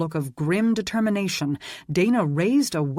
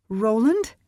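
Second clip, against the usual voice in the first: worried call, higher; concerned; 6 semitones higher, 4 dB louder than usual. A narrator's reading. Sarah checking on her tased husband, worried call. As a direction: call his name anxiously. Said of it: "she called with concern".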